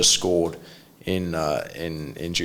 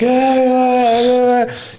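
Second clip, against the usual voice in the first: high whiny imitation of a man crying out; mocking a fussy patient's high whine; mock babyish whimpering; 6 dB louder, much slower than usual. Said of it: "just acting like a baby".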